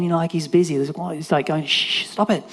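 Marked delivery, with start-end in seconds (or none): 1.6-2.4 higher-pitched shushing; mock rebuke. Crowd silencing blind Bart, mock scolding. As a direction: shush him, imitating a bystander. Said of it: "Other people are rebuking him".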